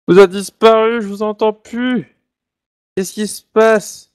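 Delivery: high, slow and alarmed; suddenly can't hear anyone, puzzled; surprised. Real, not acted. Host suddenly loses the others' audio. (surpris et inquiet, voix haute et lente)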